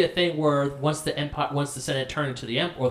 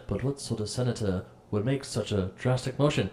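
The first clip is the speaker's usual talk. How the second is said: low, hushed politician voice; sly senator, hushed and low; fake politician voice; 4 semitones lower, 6 dB quieter than usual. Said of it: "he's like".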